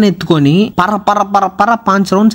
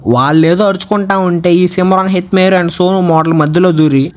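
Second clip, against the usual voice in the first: ordinary talk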